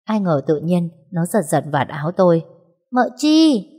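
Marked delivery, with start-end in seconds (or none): 2.9-3.7 put-on high, loud little boy's call; child calling out. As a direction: tug her sleeve and call her. Little boy calling his aunt. (bé trai gọi to, giọng cao)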